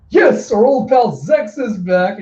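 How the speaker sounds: high, loud, drawn-out announcement; excited slow announcer call; arena announcer. Acted. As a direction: hype the crowd like a ring announcer. Arena announcer welcomes fighter back.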